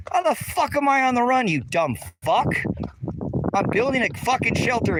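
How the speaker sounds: high, slowing down, furious disbelief; angry rant. Real, not acted. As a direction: hammer the insult home. Ranting at the commenter.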